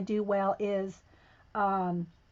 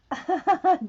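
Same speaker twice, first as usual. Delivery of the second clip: short, loud, high laugh; amused laugh; 8 semitones higher, 7 dB louder than usual. Real, not acted. Reading Janet's reply in chat, amused burst.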